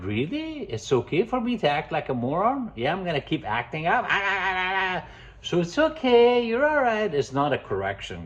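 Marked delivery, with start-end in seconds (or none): 0.0-4.0 high, cheeky dog voice; dog's voice. Retold or imitated. Dog answering owner's petting, high cheeky voice. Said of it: "the dog like". 4.1-5.0 mock dog yapping. Dog voice barking mockingly. 5.4-7.1 high, loud soothing coo; cooing owner. Retold or imitated. Owner soothing reactive dog, high baby talk. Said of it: "you like".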